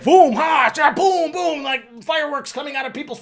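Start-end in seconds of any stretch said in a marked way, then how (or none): 0.0-1.6 higher, louder boom sound effects; cartoon magic blasts with your mouth; loud mouth explosions. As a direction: blast out fireworks noises.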